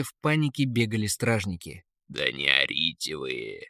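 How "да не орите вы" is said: low, unruffled eagle; eagle's voice. A narrator's reading. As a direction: quell the panic with a deep voice. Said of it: "остановил их Эрвин". (низкий голос орла)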